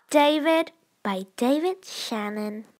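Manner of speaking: ordinary delivery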